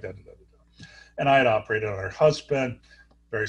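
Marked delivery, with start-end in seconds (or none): none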